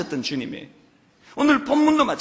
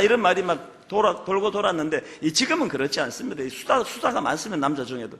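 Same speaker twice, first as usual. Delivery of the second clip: ordinary talk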